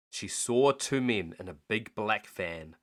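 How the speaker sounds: ordinary delivery, nothing marked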